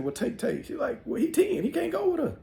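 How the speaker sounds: very high squeaky voices; back-and-forth voices. Retold or imitated. Grandma and uncle arguing over the boy, very high. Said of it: "She said".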